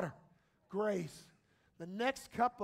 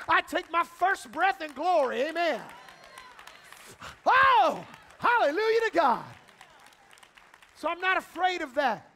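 louder, exultant declaration ending in a hallelujah; rising preaching; 5 semitones higher, 8 dB louder than usual. Acted. Pastor tells the church he's unafraid of death, loud and triumphant. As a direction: build to a joyful shout.

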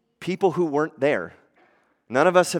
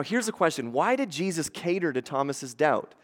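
usual speaking voice

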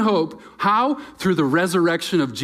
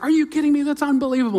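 much higher, louder burst of delight; amazed disbelief; 13 semitones higher, 6 dB louder, much faster than usual. Retold or imitated. Retelling [name]'s delight at the chair, high and amazed. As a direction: gasp it out like she can't believe it.

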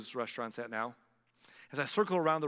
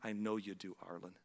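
softer and lower, fading at the name; God's gentle reply; 5 semitones lower, 6 dB quieter than usual. Retold or imitated. God answering the pastor by name, softly. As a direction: say it like a quiet assurance. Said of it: "his voice could be saying".

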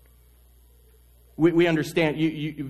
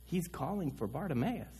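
much softer, turned-around tone; awed hush; 13 dB quieter than usual. Acted. Crowd realizing Jesus calls the beggar, hushed. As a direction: say it hushed, in sudden respect. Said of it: "all of a sudden go from".

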